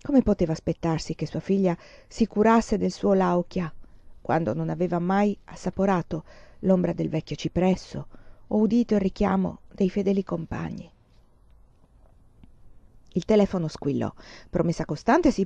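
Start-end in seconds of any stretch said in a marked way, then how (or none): none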